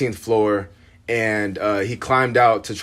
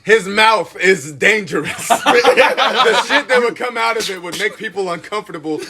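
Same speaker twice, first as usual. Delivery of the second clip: high-pitched, talking through real laughter; laughing; 8 semitones higher than usual. Real, not acted. Cohost raving about his friend's outrageous jokes, laughing. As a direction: crack up while telling it.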